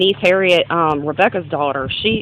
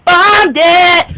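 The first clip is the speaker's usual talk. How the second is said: Bombed it! shouted high and loud; loud high exclaim; 11 semitones higher, 9 dB louder than usual. Real, not acted. To the viewer about her failed class, a loud high cry. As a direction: belt out Bombed it! with a self-mocking cry. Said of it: "I totally bombed it".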